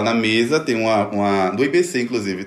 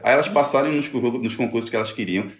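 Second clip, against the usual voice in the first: usual speaking voice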